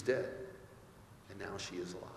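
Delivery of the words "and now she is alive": low and very soft, almost whispered at the end; hushed. Real, not acted. Preacher closing on the girl raised to life, hushed. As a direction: let it sink to a hushed close. Sermon climax on the resurrection.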